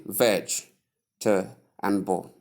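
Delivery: "veg, te, bo" as three separate beats; split into syllables. Shown as a demonstration. Teacher splits vegetable into three, syllable-by-syllable demo. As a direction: break it up: VEJ, pause, TUH, pause, BUL. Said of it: "split into three syllables".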